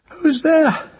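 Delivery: high, thin crone asking from bed; a frightened old hag calls out; old witch voice. Acted. Cursed old woman calls out to the child, high and frightened.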